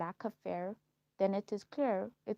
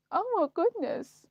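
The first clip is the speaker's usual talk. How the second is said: high, loud gush of delight; excited squeal; 10 semitones higher, 9 dB louder than usual. Real, not acted. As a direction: gasp it out like a giddy schoolgirl. Exclaims over crush memory.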